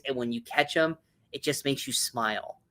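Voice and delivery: ordinary talk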